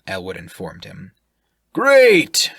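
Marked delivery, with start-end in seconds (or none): 1.7-2.5 loud hearty great. Acted. Shirk replies.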